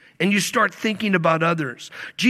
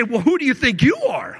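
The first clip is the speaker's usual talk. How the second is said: higher, louder indignant snap; offended retort; 6 semitones higher, 3 dB louder than usual. Retold or imitated. Faker offended at being called loser, indignant. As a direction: bristle back indignantly. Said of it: "They say".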